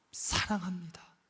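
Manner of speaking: unvoiced, hushed confession of love; whisper. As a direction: breathe it like a secret vow to the Lord.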